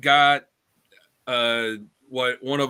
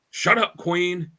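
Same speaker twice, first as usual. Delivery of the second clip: higher, louder, drawn-out mock yell; mock scream; 7 semitones higher, 5 dB louder, much slower than usual. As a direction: yell it slowly, imitating an angry order. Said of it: "Batman in the video just screams".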